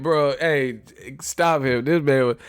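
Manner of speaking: ordinary delivery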